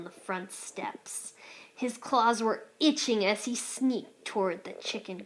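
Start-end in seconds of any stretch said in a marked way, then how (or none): 0.0-1.3 slow, hushed whisper. A narrator's reading. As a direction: tiptoe through the words. Tiptoeing narration.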